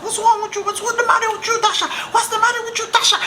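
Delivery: very high mock mother voice; put-on high-pitched maternal scolding; imitated alarmed mama. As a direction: squeal it like a mom scolding in panic. Mama confronting her, acted out.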